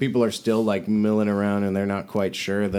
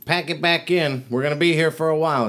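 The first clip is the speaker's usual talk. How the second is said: high, raised voice ordering the crew; yelled orders; 8 semitones higher, 5 dB louder than usual. Acted. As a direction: call instructions out to your traders. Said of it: "And she yells at".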